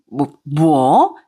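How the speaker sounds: slow shocked stammer, very drawn out; stunned stammer. Acted. Mother-in-law stunned, stammering in shock. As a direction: gasp it out in disbelief.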